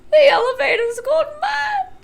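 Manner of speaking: very high, shaky shout; laugh-cry in panic; hysterical cry. A narrator's reading. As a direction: grandpa half laughing, half screaming. Grandpa Joe hysterical.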